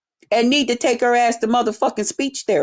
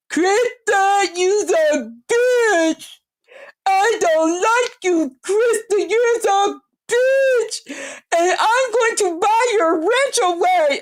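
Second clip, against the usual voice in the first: mocking her voice; 6 semitones higher than usual. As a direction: imitate her in a high, stretched whine. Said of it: "In case you don't know how you talk".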